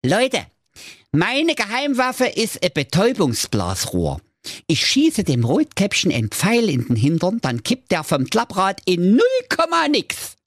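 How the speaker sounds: high squeaky dwarf, proud of his blowpipe; boasting dwarf. Acted. Dwarf Justin boasts of his blowpipe, squeaky and proud. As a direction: show off like a tiny secret agent.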